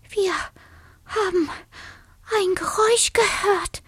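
high, halting little mouse voice; timid explanation. A narrator's reading. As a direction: pause fearfully between the first words. Scared dormouse explains a noise.

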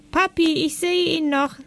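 put-on high-pitched child calling out; acted high kiddie voice; child's voice. A narrator's reading. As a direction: call to daddy like an excited little kid. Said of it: "rief eine Kinderstimme".

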